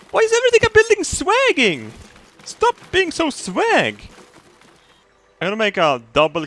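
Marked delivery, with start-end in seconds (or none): 0.1-4.1 very high, slowed mock outrage; playful high-pitched scolding; squeaky silly voice. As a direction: squeal it like a cartoon critter. Silly voice mocking the build.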